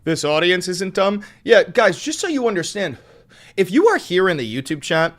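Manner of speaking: loud and high, addressing chat with fervour; rally the YouTube chat; loud, high, fired-up address; hyped up. Real, not acted.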